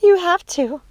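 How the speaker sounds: very high, strained little voice; high, pressing insistence; strained urging. A narrator's reading. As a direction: plead it high from a hurt throat. Choked sister urges killing.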